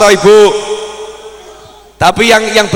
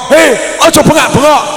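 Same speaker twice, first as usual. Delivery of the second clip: put-on scolding a child; 6 semitones higher than usual. Shown as a demonstration. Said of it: "bukan ngomong".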